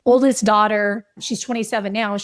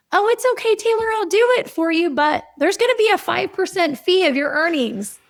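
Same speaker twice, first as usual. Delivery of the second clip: smug big brother; 7 semitones higher than usual. Retold or imitated. Son offers sister help for a fee, smug and sly.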